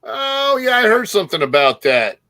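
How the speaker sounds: ordinary talk, nothing marked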